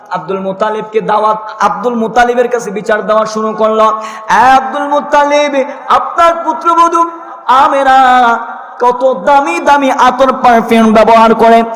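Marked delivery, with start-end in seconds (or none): 4.3-11.6 drawn-out, louder complaining plea; mock complaining townsfolk. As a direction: plead like gossiping neighbours. Townspeople complain to Abdul Muttalib.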